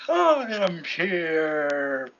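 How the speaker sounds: very slow, stretched-out hero entrance; All Might. Acted. Hero showing up after the fight, drawn-out announcement. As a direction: announce yourself grandly, dragging every word. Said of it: "All Might shows up, and he's like".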